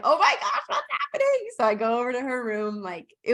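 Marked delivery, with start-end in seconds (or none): 0.0-1.5 much higher, louder startled gasp with a laugh; laughing mock panic; alarmed surprise. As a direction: shoot the pitch way up in retold alarm. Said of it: "And I'm like".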